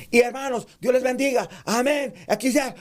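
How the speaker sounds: grito de predicador, muy agudo; predicador gritando. Shown as a demonstration. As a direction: arranca el sermón a gritos. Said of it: "todo tu sermón es gritando". (saludo de sermón gritado)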